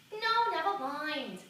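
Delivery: loud, high, drawn-out protest; stretched-out, insistent brush-off; emphatic refusal. Acted. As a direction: shut the question down, slow and loud. Refuses the interviewer more firmly.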